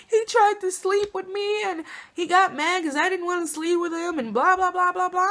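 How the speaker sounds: a hint of teary, wounded retelling; crying cousin. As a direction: imitate a tearful cousin rattling off what happened. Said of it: "my cousin just crying and crying".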